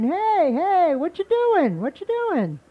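loud, high startled hollering; hollering alligator. Acted. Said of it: "Alligator started hollering".

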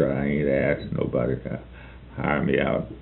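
put-on soft, timid boy's reply; shaky voice. A narrator's reading. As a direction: say it quietly, losing nerve. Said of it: "he said in the shaky voice".

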